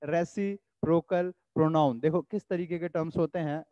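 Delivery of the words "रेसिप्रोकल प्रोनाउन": drawn-out, careful classroom dictation; slow dictation. Shown as a demonstration. Teacher dictates the term to students, very slow. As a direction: spell the term out very slowly for notes. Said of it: "dictating term slowly".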